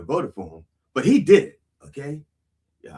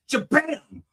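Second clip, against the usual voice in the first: high, punched "Shabam"; imitated impact; 10 semitones higher than usual. Preacher imagining passing out, mock thud. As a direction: burst out a mock crash noise. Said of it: "maybe I'll fall on that side".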